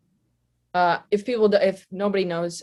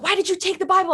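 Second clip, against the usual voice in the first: mock angry boss; 11 semitones higher, 3 dB louder, faster than usual. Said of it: "so Kyle's like".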